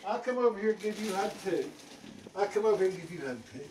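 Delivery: very soft, low bedtime murmur; tender. Real, not acted. Grandpa to grandchild at bedtime, hushed and tender.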